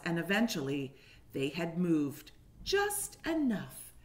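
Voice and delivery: put-on lively storybook reading for little kids; reading to children. A narrator's reading. Teacher reading a picture book to kindergartners, animated. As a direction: paint each moment of the story for the class.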